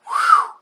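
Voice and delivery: a shriek of a whew, very high and loud; shrill whoop. Acted. Warning about angry females, piercing high whew.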